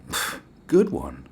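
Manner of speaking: slow, dry sarcastic "good one"; deadpan sarcasm. Retold or imitated. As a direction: brush it off with slow mock praise. Sarcastic dismissal of police.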